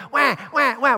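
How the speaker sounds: high playful wah wah wah; hungry baby's mock wail; mock baby crying. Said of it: "We cry".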